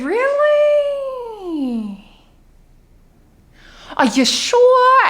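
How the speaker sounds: very high, drawn-out astonishment; theatrically surprised mother; stunned disbelief. Acted. Mother reacts to coming-out.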